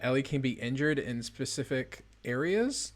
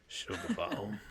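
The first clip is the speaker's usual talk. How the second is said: lower, softer sultry sugar bomb; 6 semitones lower, 6 dB quieter, slower than usual. Shown as a demonstration. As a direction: purr the words sugar bomb. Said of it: "sexual voice".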